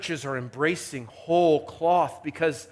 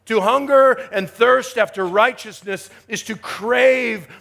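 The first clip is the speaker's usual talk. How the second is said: forceful sermon delivery, leaning on key words; emphatic preaching; 6 semitones higher, 7 dB louder than usual. Real, not acted. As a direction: preach it with rising and falling emphasis.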